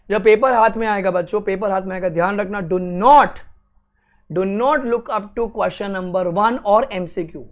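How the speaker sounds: ordinary talk, nothing marked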